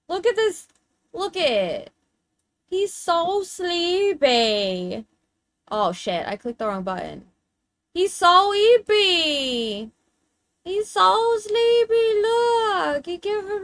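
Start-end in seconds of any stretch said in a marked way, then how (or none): 0.1-5.1 put-on high, very slow baby talk to a pet; drawn-out pet voice; cooing pet talk. 7.9-9.9 put-on high, very slow baby talk to a pet; drawn-out pet voice; cooing pet talk. 10.6-12.2 put-on higher, slow baby talk with a louder swell; high sing-song pet voice; cooing pet talk.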